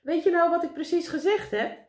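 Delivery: high, loud, insistent question; calling out. A narrator's reading. Meneer Pen checks that Karel listened, calling out. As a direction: call the question up to someone overhead. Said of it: "riep meneer Pen".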